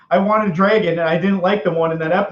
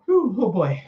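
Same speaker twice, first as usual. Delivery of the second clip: ordinary talk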